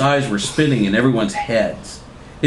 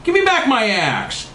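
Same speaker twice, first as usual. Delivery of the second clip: high-pitched comic outcry; high theatrical demand; cried out; 13 semitones higher than usual. A narrator's reading. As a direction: cry out high, falling at the end. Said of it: "Berger cried".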